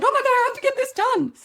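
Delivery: hammed-up hustle stress, high and rushed; frantic panic. Shown as a demonstration. Podcaster showing listeners hustle energy, frantic high fast voice. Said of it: "You hear the difference, don't you?".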